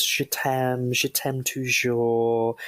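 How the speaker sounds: slow, drawn-out French je t'aime toujours; French quote. Retold or imitated. Podcaster quoting [name]'s French lyric, slow and drawn out. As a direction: stretch out toujours, French style. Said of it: "She goes".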